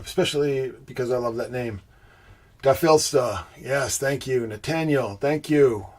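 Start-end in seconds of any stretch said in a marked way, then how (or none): none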